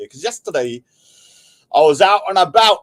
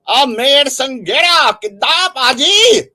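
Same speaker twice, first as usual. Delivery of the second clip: high, loud, stretched-out hail; rousing shout-out; 8 semitones higher, 6 dB louder, slower than usual. Real, not acted. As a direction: holler it like a rallying call. Hailing a viewer by name.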